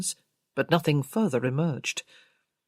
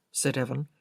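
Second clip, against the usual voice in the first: plain reading voice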